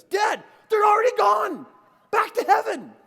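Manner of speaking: put-on very high, loud child's shout; amazed kid yelling. Son turns round and finds angels gone, amazed yell. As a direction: imitate a boy screaming in astonishment. Boy looks back, amazed.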